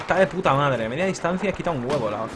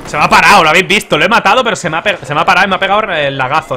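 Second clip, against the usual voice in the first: frustrated outburst; 3 semitones higher, 9 dB louder than usual. Real, not acted. Frustrated outburst over lag.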